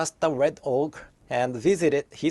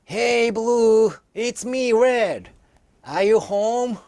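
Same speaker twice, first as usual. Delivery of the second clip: calling out much higher and louder; shouted; 7 semitones higher, 5 dB louder, slower than usual. A narrator's reading. Red orc calls to blue orc from outside, loud and high. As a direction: shout it through the locked door. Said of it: "He shouted from outside".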